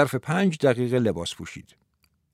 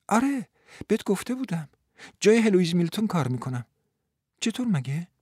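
gently comforting woman; 6 semitones higher than usual. Acted.

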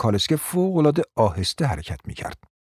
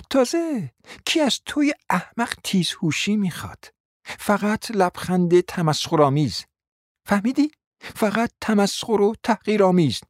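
much higher, mocking scold; scornful scolding; 9 semitones higher than usual. A narrator's reading. Wife mocking her husband's stupidity, high scornful scold. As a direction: pour scorn on the idiot.

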